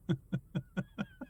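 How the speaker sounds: high, soft stuttering cackle; maniacal laugh. Acted. Driver laughing at his passenger, maniacal cackle. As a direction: build a crazed driver's cackle from quiet. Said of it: "While he's laughing?".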